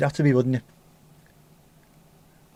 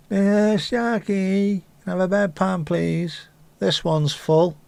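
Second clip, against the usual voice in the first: much higher, meek patient voice, slowing at the end; needy patient; 8 semitones higher, much slower than usual. Pretend. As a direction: plead sweetly for a bedpan. Patient voiced asking Jackie.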